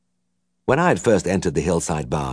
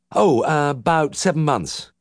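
a hint of hesitant, higher voice; flustered young vet; 9 semitones higher, slower than usual. A narrator's reading. Young vet answering the uncle, flustered. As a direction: stammer out the answer.

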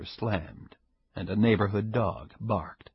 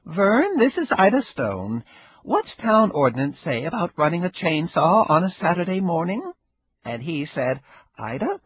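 higher, lightly voiced old woman, wry and amused; old Ida, sly; 9 semitones higher, 5 dB louder than usual. Ida needling her neighbor, a higher sly old-woman voice. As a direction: play the sly old neighbor enjoying herself.

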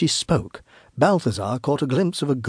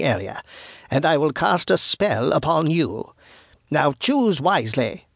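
menacing witch; 3 semitones higher than usual. Acted. Witch warns the wise men to return, menacing. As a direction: threaten them as an old witch, stretching the words.

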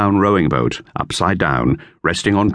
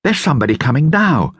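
higher, lightly voiced boy; boy's voice, lifted higher; Dick; 7 semitones higher than usual. A narrator's reading. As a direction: read it as young Dick. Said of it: "said Dick".

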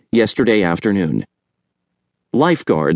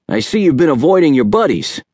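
Uncle Jerry, lightly voiced; 6 semitones higher, faster than usual. A narrator's reading. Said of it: "Uncle Jerry peered at Chet".

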